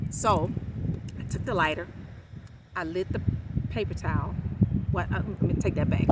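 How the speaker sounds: normal talking voice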